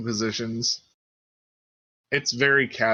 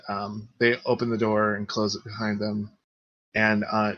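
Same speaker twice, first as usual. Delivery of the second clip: ordinary delivery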